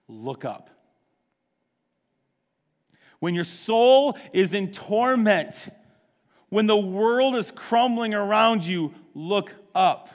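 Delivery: ordinary talk, nothing marked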